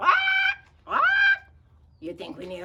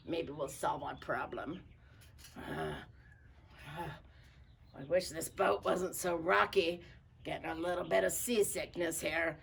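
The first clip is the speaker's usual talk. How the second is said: plain reading voice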